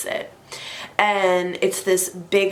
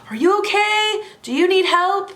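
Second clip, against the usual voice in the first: shouting; 11 semitones higher than usual. Retold or imitated. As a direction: call from across the parking lot. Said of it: "I hear people shouting".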